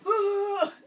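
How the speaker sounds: loud, high imitated dog howl; sudden old-man holler, imitating a dog; old man yell. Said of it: "Like an old man".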